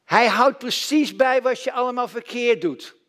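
usual speaking voice

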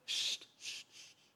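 soft unvoiced shushing; quiet shushing hiss; hushing sst. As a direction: hush the generals with a sharp sst. David hushing his generals.

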